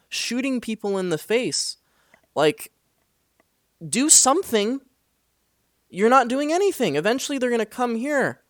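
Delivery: high, loud yelling at her to go help; retold angry yelling.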